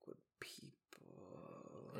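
very soft, drawn-out murmur; fading whisper. Real, not acted. Speaker losing the thought, slow whispered trail-off. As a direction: let the words die away slowly.

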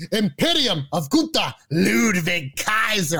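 drawn-out ring introduction; Samantha's announcer style. Retold or imitated. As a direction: announce the names slowly and grandly. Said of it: "I love the way Samantha says that".

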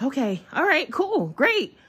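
mock-calm reply that jumps up in pitch; nervous excitement. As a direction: pitch climbing high, caught between nerves and joy. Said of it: "my heart is beating out my chest".

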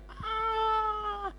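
sudden high-pitched imitated shriek; mock scream. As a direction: shriek like someone startled by a virtual drop. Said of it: "Teriak dia".

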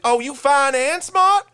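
high, slow mock swooning woman; high drawn-out mock flattered voice; mock flattered girl. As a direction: gush it like she's charmed. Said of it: "She's like".

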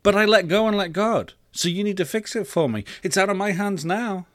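put-on high-pitched kid making excuses; protesting boy.

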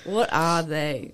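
slow, drawn-out repeat. Real, not acted. Repeats question slowly.

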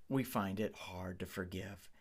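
ordinary talk, nothing marked

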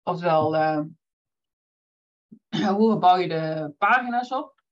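just after a sip, clearing throat; while sipping a drink. Real, not acted. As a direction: talk right after swallowing. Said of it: "Eerst even een slokje nemen".

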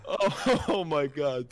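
high, laughing exclamation; real laughter. Real, not acted. Storyteller losing it with co-host, laughing exclamation. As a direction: gasp out the words through a laugh.

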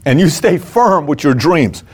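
ordinary talk, nothing marked

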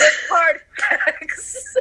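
slow, drawn-out, mock-serious; mock-solemn. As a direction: drag out The hard facts like a documentary. Mock-serious echo of "facts.

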